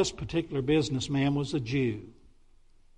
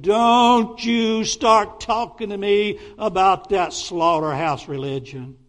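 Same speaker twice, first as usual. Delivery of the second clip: louder, slow, hammered-out scolding; angry retort; 5 semitones higher, 7 dB louder, slower than usual. Retold or imitated. Shopkeeper rejecting the pastor's tract, loud angry scolding. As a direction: scold him hard and slowly. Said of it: "he yelled at me".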